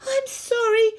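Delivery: high, loud, distraught plea; panting distress. A narrator's reading. Henry apologising for dropping his brother, high distressed panting. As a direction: plead it in a panicky rush. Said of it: "panted Henry".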